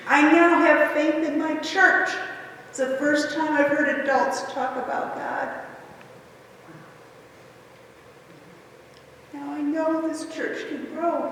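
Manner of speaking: holding back tears while quoting him; teary. Real, not acted. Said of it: "sorry, I'm getting teary".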